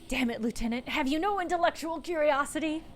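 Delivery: mock-indignant appeal, rising in pitch; indignant protest. Player protests to the lieutenant about returning to work, indignant.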